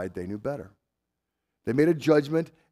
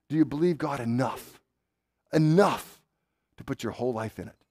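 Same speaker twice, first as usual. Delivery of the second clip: usual speaking voice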